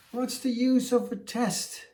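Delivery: a big beast sneering at a tiny challenger; scoffing elephant. Acted. Elephant mocks the tortoise's test, high and loud.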